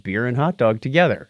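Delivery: usual speaking voice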